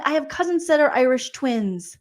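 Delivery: ordinary talk, nothing marked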